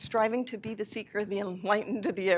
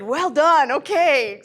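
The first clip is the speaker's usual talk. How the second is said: loud, high exasperated okay; loud high surrender to the dog; loud giving in; 7 semitones higher, 14 dB louder, slower than usual. Retold or imitated. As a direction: throw up your hands loudly.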